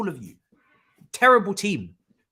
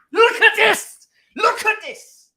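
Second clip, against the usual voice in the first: exasperated shout; 10 dB louder, slower than usual. Real, not acted. Fan reacts to his team.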